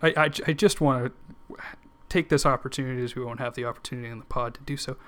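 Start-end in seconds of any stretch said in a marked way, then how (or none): none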